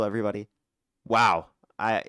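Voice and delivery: ordinary delivery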